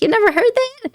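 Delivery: hammed-up disbelief; high mock friend voice. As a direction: play the shocked friend. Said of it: "It's like".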